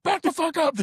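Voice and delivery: high-pitched barked warning; hammed-up high command; mock threatening yell. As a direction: act out a frantic order to back off. Same warning acted once more.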